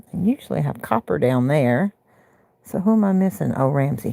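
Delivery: ordinary talk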